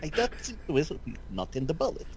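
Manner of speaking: high, loud broken Russian English; Russian accent. Pretend. Joining Russian accent bit.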